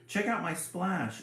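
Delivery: put-on a bragging kid whining for attention; whined. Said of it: "Fancy Annie whined".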